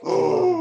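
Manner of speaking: high sharp gasp of shock. Retold or imitated. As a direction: gasp like your heart just dropped. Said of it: "he was like".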